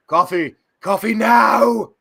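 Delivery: mock caffeine-starved roommate yell; imitating a roommate's demand. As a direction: bark it like a desperate craving. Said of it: "the phrase that Clint would use".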